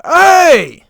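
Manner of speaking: loud, high holler; calling out. Retold or imitated. Worker calls out to his vanished helper, loud holler. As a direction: shout to get someone's attention.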